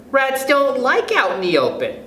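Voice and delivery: put-on high-pitched girl character voice; child objecting. A narrator's reading. Agnes objects to Stuart's idea about rats, high child voice.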